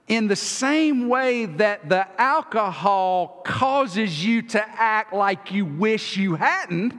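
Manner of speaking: ordinary talk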